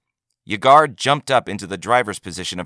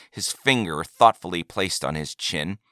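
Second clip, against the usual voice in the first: ordinary delivery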